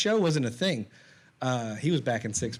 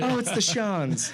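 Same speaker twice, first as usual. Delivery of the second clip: announcer voice; 6 semitones higher, slower than usual. Pretend. Announcing the celebrity couple.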